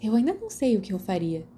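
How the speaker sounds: ordinary delivery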